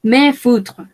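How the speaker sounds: "Me foutre" with no S sounded; silent S. Shown as a demonstration. Teacher models French for learners, silent S. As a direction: say "Me foutre", leaving the written S silent. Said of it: "no pronunciamos la S de acá".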